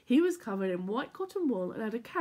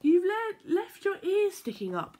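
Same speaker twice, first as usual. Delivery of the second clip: teasing; 9 semitones higher, 5 dB louder than usual. A narrator's reading. Little Bear teasing Rabbit.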